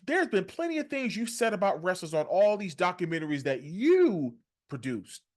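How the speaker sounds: ordinary talk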